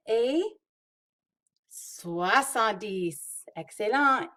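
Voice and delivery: bright quiz with big praise; talking to toddlers. Acted. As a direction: cheer each right answer like a preschool teacher. Quizzing kids, praising answers.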